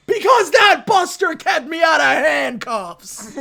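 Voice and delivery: high, loud, slow yell with a laugh; mock-heroic bellow; shouted defence. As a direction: defend your friend, hammed up. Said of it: "Dom turns to him and goes".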